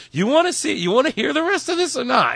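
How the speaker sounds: high, put-upon grown-up nagging; scolding elder, hammed up high; mock grandparent. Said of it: "You're like".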